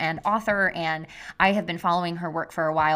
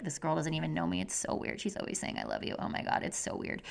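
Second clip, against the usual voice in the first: hushed, voiceless whisper of an imagined doubtful listener; mock listener whisper; 2 semitones lower, 10 dB quieter, faster than usual. Host imitating listeners who find her love weird, whispered. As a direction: breathe it like a skeptical aside behind her back. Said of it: "you're probably like".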